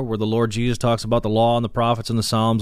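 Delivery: ordinary delivery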